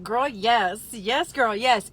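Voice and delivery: put-on loud, gleeful girl yes; body cheering. Her body welcomes the MSG with a loud cheer. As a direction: cheer it on like a hype friend. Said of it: "My body's like".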